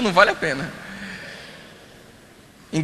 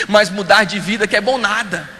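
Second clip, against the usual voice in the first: usual speaking voice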